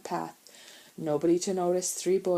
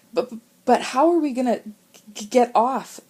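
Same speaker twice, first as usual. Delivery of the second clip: loud, fast stammer with chattering teeth; 6 semitones higher, 9 dB louder than usual. A narrator's reading. Frozen boy stammers to his brothers, teeth chattering. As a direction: stutter through cold and fear. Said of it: "teeth were suddenly chattering".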